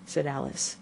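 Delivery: usual speaking voice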